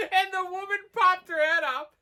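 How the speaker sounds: high, giggly, laughing as she talks; can barely get it out for laughing; laughing through it. Real, not acted.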